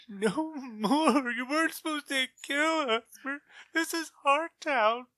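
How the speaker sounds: high, whiny, blubbering voice of a beaten thug; crying thug. Acted. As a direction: wail it like a terrified loser begging. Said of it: "one guy starts crying".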